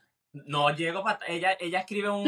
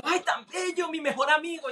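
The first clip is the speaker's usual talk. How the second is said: put-on much higher baby-talk coo with a laugh; cooing at cat; 8 semitones higher than usual. Man doting on the cat, high laughing baby talk. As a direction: gush over the cat, laughing.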